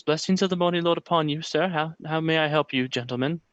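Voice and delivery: high, quick, earnest little acolyte voice; a pious boy greeting strangers; young boy. Acted. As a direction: GM voicing the boy Yeska, high and eager. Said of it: "he says".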